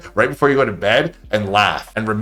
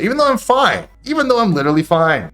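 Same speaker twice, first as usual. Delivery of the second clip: high insisting squeak; 8 semitones higher than usual. Real, not acted. Insisting he is fine.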